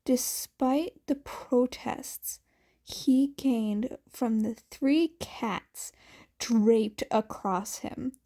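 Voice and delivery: plain reading voice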